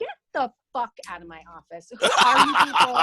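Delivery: acted-out angry outburst, building to a shout; re-enacted indignant yell; retold yelling. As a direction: throw them out, getting loud on the question. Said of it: "screaming and yelling".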